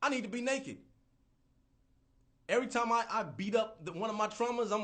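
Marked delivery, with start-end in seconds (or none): 0.0-0.8 high, loud epiphany; sudden realization. Retold or imitated. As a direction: blurt it out like a revelation.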